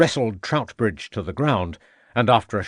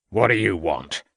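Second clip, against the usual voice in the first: a curt gruff farmer's snap; snapped; 3 semitones lower than usual. A narrator's reading. Farmer snapping at the police. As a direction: snap it at the intruders. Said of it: "he snapped".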